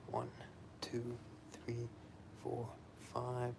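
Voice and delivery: soft breathy count under the breath; whispered counting. Real, not acted. Quiet counting through exercise reps.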